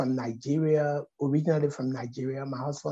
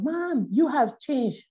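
daughter marvelling; 8 semitones higher, slower than usual. Retold or imitated. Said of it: "my daughter said".

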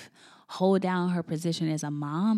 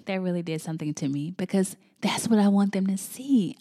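ordinary talk

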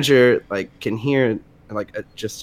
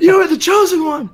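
high, loud mock-dramatic movie hero voice; movie Jedi line; 6 dB louder than usual. Joking about a fast-food coworker, hammed-up Jedi voice. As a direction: declaim it like an epic film mentor. Star Wars joke, Order 66.

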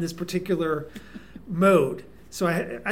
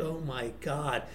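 slow, hushed retold dismay; stunned; 4 semitones lower, 8 dB quieter, slower than usual. Recognized by the whole club, hushed mock dismay. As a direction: say it low and slowly, taken aback.

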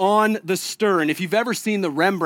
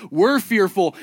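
ordinary talk

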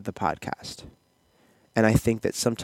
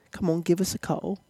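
put-on British accent; 9 semitones higher than usual. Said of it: "You start talking like them".

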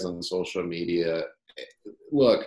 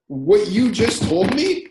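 excited reply; 9 semitones higher, 5 dB louder than usual. Retold or imitated. Founder telling the customer how valuable the complaint was, excited. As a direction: stretch it out, wide-eyed and eager.

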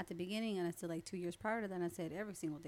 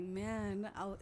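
ordinary talk

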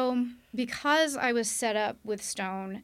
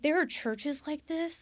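high, astonished question; amazed disbelief; 7 semitones higher than usual. Retold or imitated. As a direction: marvel at it like it can't be real. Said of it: "I was like".

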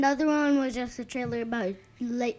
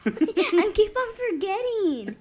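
laughing; 7 semitones higher, 8 dB louder, slower than usual. Real, not acted. Laughing at own forgetfulness.

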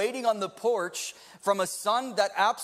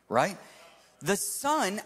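usual speaking voice

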